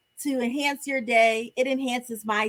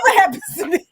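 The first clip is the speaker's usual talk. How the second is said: high laughing repeat; laughing quote; 7 semitones higher, 6 dB louder, much faster than usual. Retold or imitated. Cohosts echo Mark's line, laughing.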